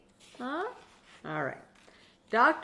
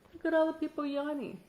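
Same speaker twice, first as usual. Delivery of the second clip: ordinary delivery, nothing marked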